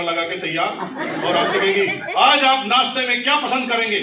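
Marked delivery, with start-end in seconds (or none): 2.2-4.0 high, eager-to-please wifely voice; delighted wife. Acted. Delighted wife asking husband about breakfast, high and eager. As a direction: play a thrilled wife offering a treat.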